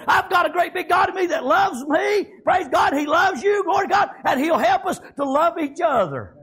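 ordinary talk

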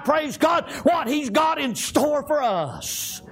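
rhythmic, driving revival preaching; full-force country revival sermon; fervent preaching. Real, not acted. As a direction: preach it in chanted bursts with gasped breaks.

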